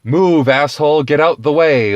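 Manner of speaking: loud jeering mob; pushy crowd shouting at Pilate; mock crowd shout. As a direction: yell it like an angry rabble.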